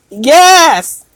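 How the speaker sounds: ordinary talk, nothing marked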